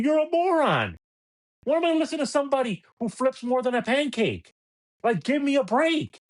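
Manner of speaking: mocking a flip-flopping radio host; scornful outburst. Real, not acted. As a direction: fling the insult up and out. Insulting host in rant.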